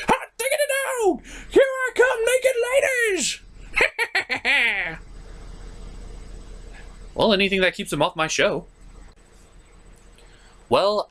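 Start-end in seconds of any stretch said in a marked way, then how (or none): 0.1-3.4 very high, elated whoop; gleeful whoop. Acted. A lecherous sidekick cheering about the magic mirror, very high and gleeful. 3.7-6.9 high breathy snicker; high giggle. Acted. A lecherous sidekick running off to the mirror, giggling high.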